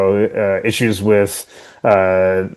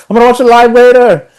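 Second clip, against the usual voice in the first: drown out spoilers with a high, loud chant; mock blocking-out; 13 semitones higher, 7 dB louder than usual. Host acts someone with fingers in ears avoiding spoilers, mock yell. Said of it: "fingers in your ears".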